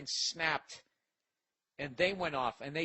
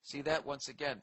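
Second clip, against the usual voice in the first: usual speaking voice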